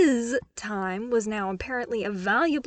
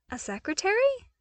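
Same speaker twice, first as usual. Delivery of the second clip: surprised; 12 semitones higher than usual. Acted. Luke baffled by suggestion.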